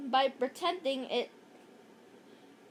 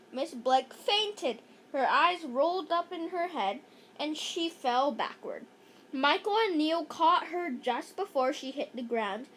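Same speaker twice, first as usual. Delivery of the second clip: usual speaking voice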